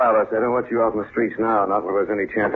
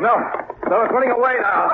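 calling out. Acted. Shouting after fleeing animal.

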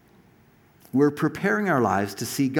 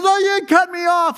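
high, loud mock whine of the angry truck driver; angry trucker; 8 dB louder than usual. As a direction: mimic the trucker complaining he got cut off, much higher. Angry trucker voiced in story.

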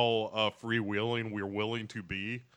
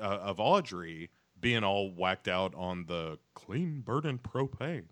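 ordinary talk, nothing marked

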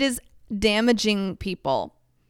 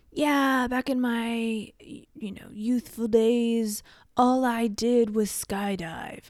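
slow, drawn-out mock interviewee voice; interviewee reminiscing; 3 semitones higher, slower than usual. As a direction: play someone grandly recalling their youth in an interview. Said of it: "like the people in an interview".

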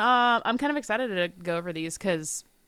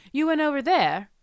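English inflection; 6 semitones higher than usual. Retold or imitated. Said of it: "he goes English all of a sudden".

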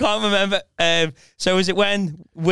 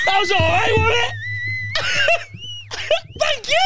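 high squealing laughter; laughing hard. Real, not acted. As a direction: laugh through the words. Laughing after a failed take.

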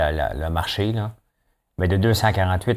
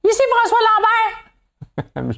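high squeaky nasal voice; pinched little nasal voice; 4 dB louder, faster than usual. Retold or imitated. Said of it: "Une petite voix nasale".

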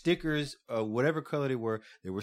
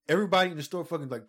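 ordinary delivery

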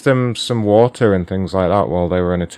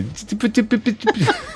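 high-pitched babbled stutter noise; mock spluttering. Host mimics the caller's reaction, mock spluttering. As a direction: imitate someone too flustered to form words.

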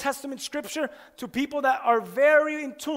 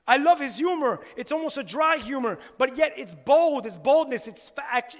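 ordinary talk

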